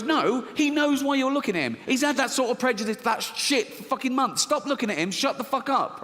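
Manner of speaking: high, exasperated rant; angry inner voice. Acted. Rational side berates the fearful one in a high, angry rant. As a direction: tear into the other side of your mind. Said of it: "This one goes".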